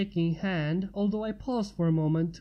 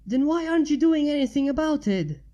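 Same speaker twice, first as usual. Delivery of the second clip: higher, louder angry shout; shouting back; 6 semitones higher, 5 dB louder than usual. A narrator's reading. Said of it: "Sam shouted back".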